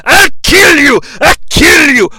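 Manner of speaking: high, loud cry of attack; mock battle yell. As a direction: yell it in a hammed-up frenzy. Acted attack by a tentacle monster.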